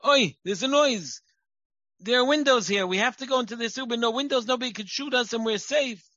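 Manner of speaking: high, loud alarmed mother; a mother voicing fear at every noise, played up; frightened mother. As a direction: herd the kids to safety in a mock panic. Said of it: "the mother trained them".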